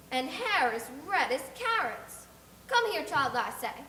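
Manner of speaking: put-on a nosy neighbour sizing up a child bluntly; blunt busybody. Neighbour ordering the orphan girl over, loud and blunt.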